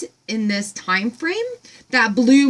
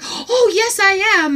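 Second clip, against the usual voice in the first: much higher, bright chirpy reply; farm woman quoted; 10 semitones higher, 4 dB louder than usual. Retold or imitated. Voicing the farm woman answering her, high and chirpy. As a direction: chirp it back delighted. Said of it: "she's like".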